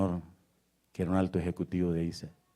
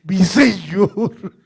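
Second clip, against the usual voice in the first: loud, high, drawn-out pleading; anguished prayer; 12 semitones higher, 14 dB louder, much slower than usual. Acted. Preacher cries to Christ about the cross, in loud anguished prayer. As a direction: cry out to God, falling away between bursts.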